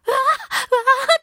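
high, slow, loud gasps of wonder; reenacted overwhelmed cooing; awestruck squeal. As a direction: gasp and squeal like a fan. Acting out awe at the actress.

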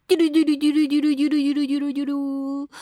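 fast rolled "duru-duru" drum roll; vocal drum roll. Pretend. Host about to announce favourite meats, vocal drum roll. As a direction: imitate a drum roll with the mouth.

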